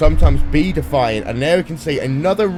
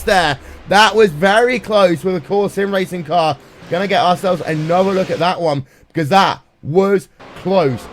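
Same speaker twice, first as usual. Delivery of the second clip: raised, higher-pitched live race call; excited commentary; 6 semitones higher, 5 dB louder than usual. Real, not acted. Race commentator calling a near crash at turn six, excitedly. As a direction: burst in urgently over a near miss.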